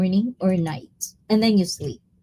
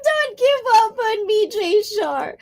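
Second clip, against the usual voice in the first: mock crying plea; 13 semitones higher than usual. Real, not acted. Pleading with viewers, half crying.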